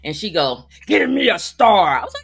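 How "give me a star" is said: loud, drawn-out mock demand; aggressive demand. Cashier demanding a rating from a customer, aggressive. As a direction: imitate a pushy cashier, much louder and slower. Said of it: "And that lady was aggressive".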